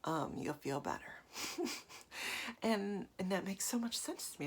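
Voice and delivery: ordinary talk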